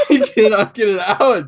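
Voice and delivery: squeaky helpless laughter; laughing through tears. Real, not acted. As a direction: laugh so hard you can't speak. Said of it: "I'm literally crying".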